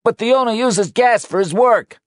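loud, high warning; shouted. Acted. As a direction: yell it so they hear. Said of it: "shouted Danny".

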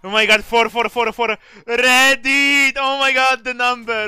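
loud high squeal of delight; excited shouting. Real, not acted. Seeing a funny number.

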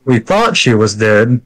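"thought" drawn out and emphasized; stress on THOUGHT. Shown as a demonstration. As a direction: lean slowly on the word "thought". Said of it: "Emphasis on the thought".

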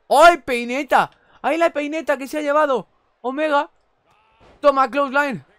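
loud, high cries at the hits; excited shouting. Real, not acted. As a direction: yell with delight at each blow. Emoción al ver un golpe. (gritos emocionados comentando golpes)

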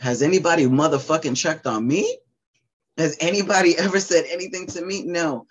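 high pained question with a bitter laugh; real bitter outburst. Real, not acted. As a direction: demand to be seen. Raw anger at her family.

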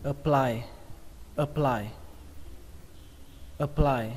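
APPLY opening on a short "uh"; schwa first vowel. Shown as a demonstration. Teacher urging learners into a habit, "uh" first. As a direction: say "apply" as "uh-PLY". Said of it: "tập cái thói quen đọc là ờ".

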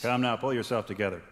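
ordinary talk

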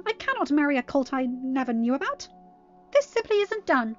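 loud, high-pitched tirade; theatrical, outraged highborn mare; indignant outrage. Acted. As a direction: rail at the scandal, voice soaring. Outraged tirade after her shriek.

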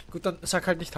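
ordinary talk

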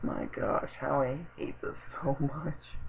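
very soft, slow groan of dismay; weary despair. Real, not acted. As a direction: say it under your breath, slowly. Despairing aside at bad writing.

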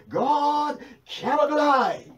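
God cannot lie, proclaimed at full force; slow forceful shout. Acted. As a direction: thunder it from the pulpit, slowly. Preacher repeats it shouting slowly.

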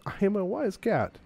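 self-important proclamation. Acted. Cat insists on its wisdom to Georgia, high proud proclamation. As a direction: pitch leaping high on a boast.

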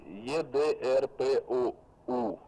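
slow letter-by-letter spelling; dictating letters. Real, not acted. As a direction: spell it out slowly. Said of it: "повторяю по буквам".

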